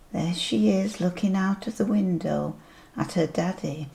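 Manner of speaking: ordinary delivery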